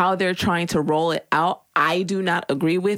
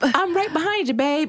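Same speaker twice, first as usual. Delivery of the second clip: much higher, airy parody of a smooth ad line; mock car-ad voice; 12 semitones higher than usual. Mocking a Lincoln commercial to the cohost, in a much higher put-on voice. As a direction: ham up a sultry luxury-car line. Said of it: "the Lincoln commercials where it's like".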